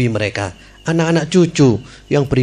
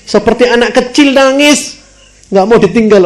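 much higher, a little louder, told with fervour; rising excitement; 11 semitones higher, 4 dB louder than usual. A narrator's reading. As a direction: paint the sobbing child vividly. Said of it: "Nangis, histeris". (bercerita penuh semangat, jauh lebih tinggi)

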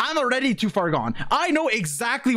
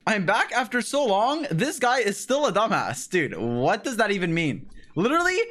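ordinary talk

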